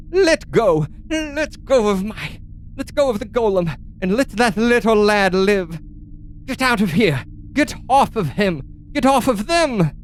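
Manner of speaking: high, strained woman's voice, pleading and commanding; bookish woman. Acted. Woman in the globes ordering the fighters off, high bookish woman voice. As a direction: cry out orders in a thin, high voice. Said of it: "Seems to be a human woman".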